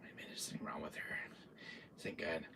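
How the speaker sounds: fast, hushed whisper of dread; frightened rushed whisper; scared whisper. Retold or imitated. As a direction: whisper it quick, like you're afraid she'll hear. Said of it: "I'm like".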